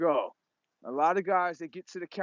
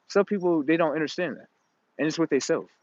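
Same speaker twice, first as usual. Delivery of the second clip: ordinary talk, nothing marked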